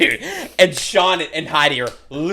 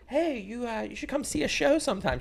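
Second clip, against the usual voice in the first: much higher, sugary cold-caller voice; chirpy telemarketer; 12 semitones higher, 8 dB quieter than usual. Retold or imitated. S0 imitates the club cold-calling her girlfriend, mock chirpy salesperson. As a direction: brightly pitch a stranger like a cold caller.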